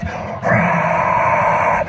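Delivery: long, hushed-throat stadium roar; imitated crowd roar. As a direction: sustain the roar of a distant crowd. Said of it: "that's a Wembley roar".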